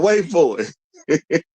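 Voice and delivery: stretched out slowly with a rising end; slow, teasing wait for it; slow teasing drawl. Shown as a demonstration. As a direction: drag it out to tease the payoff. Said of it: "teasing build-up".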